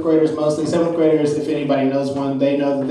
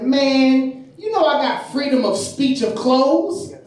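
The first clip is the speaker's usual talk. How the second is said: put-on higher-pitched kid protesting; defiant student; 8 semitones higher, slower than usual. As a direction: imitate a pupil arguing back, drawn out. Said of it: "I'm talking to the kid".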